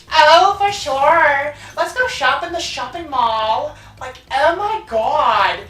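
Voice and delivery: put-on high, drawn-out Valley girl lilt. Mocking the original game's Fawns, Valley girl accent. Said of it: "sounded like typical Valley girls".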